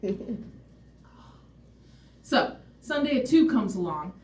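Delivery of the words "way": breathy whisper, much softer; hushed aside about her looks. Real, not acted. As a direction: lean in and confide it. Confiding aside to audience.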